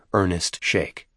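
usual speaking voice

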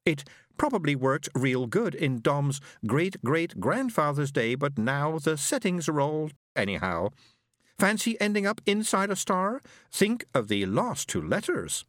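lightly voiced easygoing crewman; casual young voice. Isaac character voice.

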